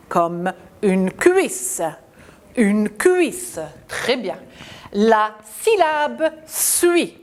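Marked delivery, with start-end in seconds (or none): 4.9-7.2 slow, high, syllable by syllable; slow model reading. Shown as a demonstration. Teacher modelling a syllable for children. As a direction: read it slowly for the class to repeat. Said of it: "répéter après moi".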